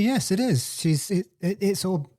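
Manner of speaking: usual speaking voice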